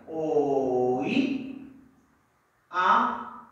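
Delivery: "Oí" drawn out, a long pause, then "a"; syllable by syllable. Shown as a demonstration. Teacher separating oíamos for pupils, syllable by syllable on "Oí, a". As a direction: break "Oí, a" into separate held syllables. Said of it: "se va a separar".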